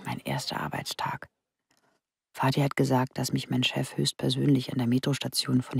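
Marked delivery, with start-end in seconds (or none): 0.0-1.3 whisper. Acted. Narrator whispering her memory.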